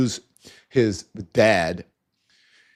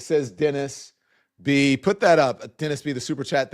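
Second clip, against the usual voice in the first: ordinary talk, nothing marked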